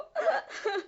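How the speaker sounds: breaking into sobs. Acted. Mother cannot finish telling of son's death, sobbing. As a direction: let grief cut the sentence off in sobbing.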